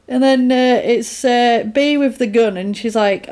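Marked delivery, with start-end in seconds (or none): none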